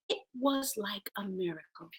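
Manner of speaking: ordinary talk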